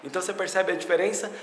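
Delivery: ordinary talk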